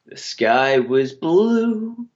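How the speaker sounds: slow sing-song recital; mock poem. Co-host mocking the host with a slow rhyme.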